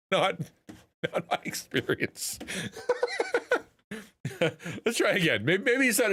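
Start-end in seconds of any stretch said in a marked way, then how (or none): 0.1-2.4 breaking up with laughter; words squeezed out between giggles, losing voice; real helpless laughter; laughing through words. Real, not acted. Laughing at the clip's imagery. 2.4-3.6 high, breathless giggles; real laughter; high-pitched laughing fit. Real, not acted. Laughs at own remark.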